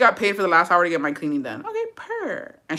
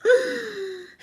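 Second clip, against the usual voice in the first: put-on higher, crying voice, slowing on "but my kids"; 13 semitones higher than usual. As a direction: break down sobbing over the kids. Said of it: "I was like".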